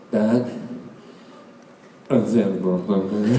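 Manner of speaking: low, mumbled mock confession; guilty confession. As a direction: imitate a guilty boy owning up, barely intelligible. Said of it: "Made me feel so guilty".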